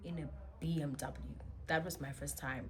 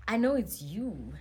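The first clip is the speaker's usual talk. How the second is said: sassy retort; 4 semitones higher, 8 dB louder than usual. Retold or imitated. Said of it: "I had attitude at the time".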